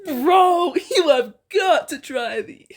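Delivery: loud, high excited bro; eager shout to a friend; excited urging. Acted. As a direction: hype it up to your buddy.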